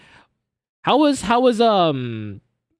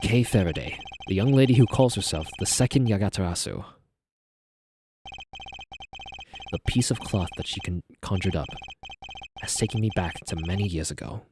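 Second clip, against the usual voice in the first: low dramatic character voice; 5 semitones lower, 6 dB quieter than usual. Acted. Voice acting game character.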